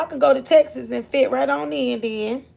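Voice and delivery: ordinary talk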